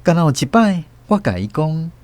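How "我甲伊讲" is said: ordinary talk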